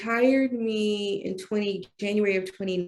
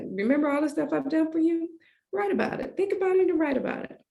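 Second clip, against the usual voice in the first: much higher, fast, insistent; voicing God; 10 semitones higher, faster than usual. God urging the speaker to write, high and insistent. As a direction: press her quickly to write.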